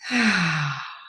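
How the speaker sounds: long sighing breath out through the mouth; audible exhale. Shown as a demonstration. Meditation teacher demonstrating a lovely exhale, audible sigh. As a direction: let the breath go in an open sigh. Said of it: "through the mouth as I did".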